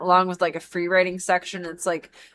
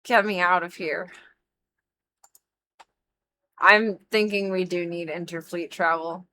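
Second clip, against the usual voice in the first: normal talking voice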